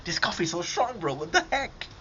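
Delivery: high, hammed-up coffee reaction; rising mock-shocked dad voice; imitating a dad. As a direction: play a dad jolted by strong coffee. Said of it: "your dad will be drinking coffee".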